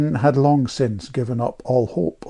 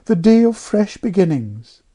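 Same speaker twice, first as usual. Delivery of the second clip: raised, pious vicar's tone; soulful vicar; 8 semitones higher, 4 dB louder than usual. A narrator's reading. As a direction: sigh it out like a sermon. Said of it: "turned up his eyes soulfully".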